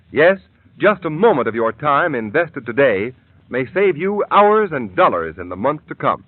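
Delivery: ordinary talk, nothing marked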